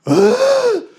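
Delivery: high, effortful grunt; straining grunt. Retold or imitated. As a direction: grunt while charging. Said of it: "he just goes".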